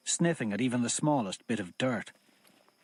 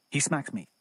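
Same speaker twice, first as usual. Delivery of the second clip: usual speaking voice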